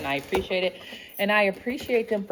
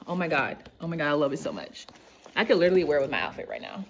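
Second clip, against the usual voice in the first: ordinary delivery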